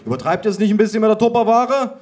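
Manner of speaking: high, loud, quickening question; exasperated outburst. A narrator's reading. Reacting to a huge plastic tub, exasperated shouting. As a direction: build to a loud, high complaint.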